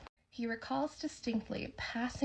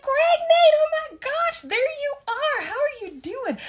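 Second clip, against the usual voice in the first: far higher and louder, gushing hello; ecstatic greeting; 8 dB louder, slower than usual. Retold or imitated. Bartender spotting the two men, ecstatic high greeting. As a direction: shriek their names like a thrilled old friend.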